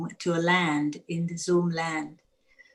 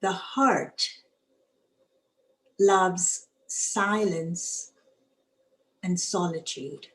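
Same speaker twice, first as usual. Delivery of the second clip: guided meditation. Acted. Leads a reflection.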